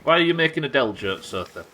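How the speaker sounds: usual speaking voice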